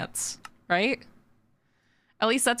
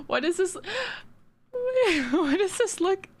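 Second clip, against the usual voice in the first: delighted gasps; 12 semitones higher than usual. Real, not acted. Gasping in delight at drawing.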